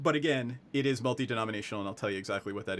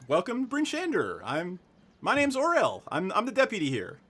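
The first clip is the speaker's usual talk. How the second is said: high-pitched, friendly deputy voice; cheery welcoming deputy; 8 semitones higher, 4 dB louder than usual. Acted. Deputy introduces herself to visitors, high and friendly. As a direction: introduce yourself in a lifted, eager voice. Game master plays the deputy.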